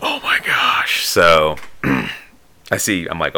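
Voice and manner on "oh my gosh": slow, breathy awe with no voice; awestruck. Retold or imitated. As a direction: let it out as a stunned, drawn-out whisper. Retold awestruck reaction to news.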